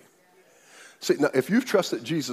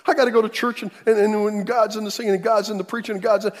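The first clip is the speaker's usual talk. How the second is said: mock anxious churchgoer, higher and hurried; whiny churchgoer; 8 semitones higher, 3 dB louder, faster than usual. Preacher mocking a congregant's worry, high and hurried. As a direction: imitate a fretful believer rushing to church. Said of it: "Why do we think".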